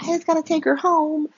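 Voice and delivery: high-pitched imitation of Alexis; mock Alexis. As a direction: pitch it up like a tired friend. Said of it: "Alexis like".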